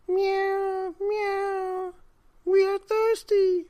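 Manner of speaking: very high, mewing cat chorus; crying cats. A narrator's reading. Thirsty cats cry to the old man, high mewing. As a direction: mew it like a crowd of thirsty cats. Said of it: "cried the hundreds of cats".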